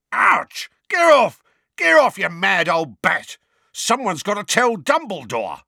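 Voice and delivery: loud, high yelps; pained protest. Acted. Mundungus fending off blows of cat food, yelping. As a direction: cry out as if being whacked.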